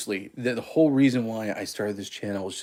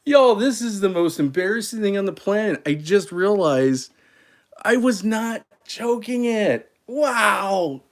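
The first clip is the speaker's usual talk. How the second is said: embarrassed outburst; 9 semitones higher, 4 dB louder, slower than usual. Real, not acted. Embarrassed at own mistake.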